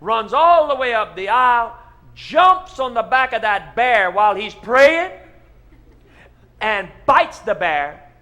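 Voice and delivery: loud, high, building preacher excitement; excited tall tale. Acted. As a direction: tell it big and breathless. Pastor continues the tall tale.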